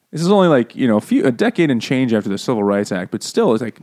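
normal talking voice

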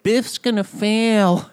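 put-on high, drawn-out nerdy kid voice. Bernard announces that Biff will fail, nerdy kid voice. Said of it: "the nerdy kid, was like".

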